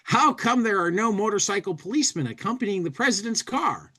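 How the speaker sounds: high, quick cartoon tourist voice; comic bubble voice. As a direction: toss it off high and fast. Reading a comic bubble aloud.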